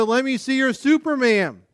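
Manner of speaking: high, loud camp call; mock leader's prompt; imitated caller's shout. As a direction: call it out to the campers. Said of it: "That said".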